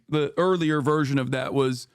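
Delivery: ordinary talk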